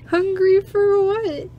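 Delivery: put-on high, slow coy girl; coy little squeak; timid heroine. Heroine voiced in story.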